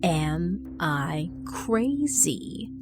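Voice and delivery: very slow, each word Am, I, crazy? pressed with menace; slow dangerous emphasis. A narrator's reading. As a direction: lean on every word of "Am I crazy?" as a threat. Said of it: "repeated slowly, putting a dangerous emphasis on each word".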